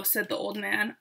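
ordinary delivery

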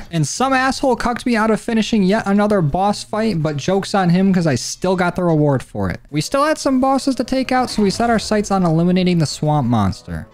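recap narration. A narrator's reading. Recap narration over gameplay.

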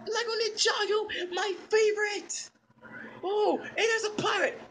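high-pitched gasping excitement; thrilled, very high kid voice; awestruck delight. Acted. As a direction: squeal over every new toy. Child discovers a big Lego collection.

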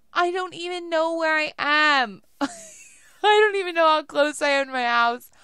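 ordinary talk, nothing marked

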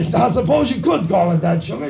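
laughing through a loud reply. Acted. Man explaining to his woman, laughing. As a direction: crack up mid-line. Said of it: "he said".

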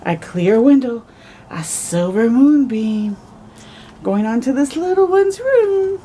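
slow soothing read-aloud; bedtime story reading. Acted. Miss Sheila closes the bedtime book for children, slow and soothing. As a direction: let the last pages drift toward sleep.

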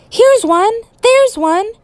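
put-on kids spotting ants, squealing out; excited kids shouting. A narrator's reading. Kids point out the ants, high excited shouts. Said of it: "kids started to shout".

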